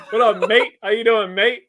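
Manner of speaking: high, loud laughing mock Aussie greeting; laughing put-on Aussie hello. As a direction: ham up a cheery "mate" greeting. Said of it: "I wish I had enough balls to do that".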